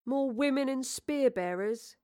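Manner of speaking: put-on slow, glum young actor. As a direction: grumble it slowly, let down. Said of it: "said glumly".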